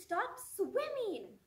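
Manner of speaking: high, slow, drawn-out urging; depression's lingering plea. Acted. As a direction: coax slowly, easing off in volume by the end. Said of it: "And the depression says".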